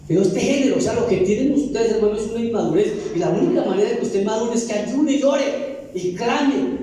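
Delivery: ordinary talk, nothing marked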